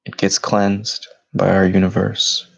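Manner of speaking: steady, even meditation voice with long pauses; measured, level-toned meditation leader, unhurried between breaths; guided meditation. Acted. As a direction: guide them gently inward, phrase by phrase. Leads guided meditation after bell.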